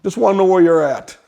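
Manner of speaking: high, loud call from far away; dad calling out. Retold or imitated. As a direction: shout it across half a mile to your son.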